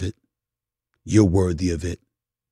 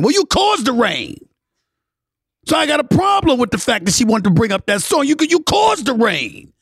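heated accusation; 10 semitones higher than usual. Real, not acted. Blaming ex over the song.

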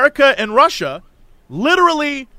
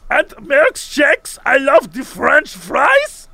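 mock accent drifting French; 6 semitones higher, much slower than usual. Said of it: "you're slipping into French accent".

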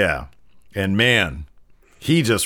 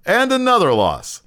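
mock commentator calling the losses; Michael Cole; 6 semitones higher than usual. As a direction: announce each one like a running tally. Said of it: "you hear Michael Cole says".

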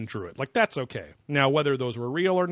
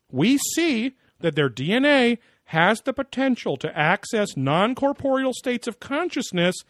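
high, mock-solemn guru voice; mock Wilcock voice; 8 semitones higher, 4 dB louder than usual. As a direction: parody a lofty pseudo-science lecturer. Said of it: "he goes".

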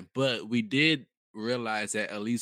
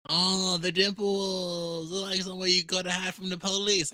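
[name] impression; 7 semitones higher than usual. Quoting [name]'s line to mother.

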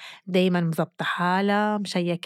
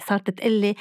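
normal talking voice

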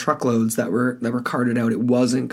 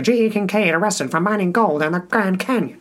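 higher vintage newsreel announcer; old-timey radio voice; 6 semitones higher than usual. Acted. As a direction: read it like a 1900s radio bulletin. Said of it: "I gotta put on my old-timey radio voice here".

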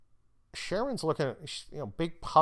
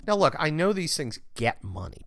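ordinary talk

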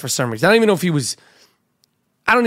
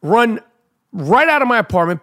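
ordinary delivery

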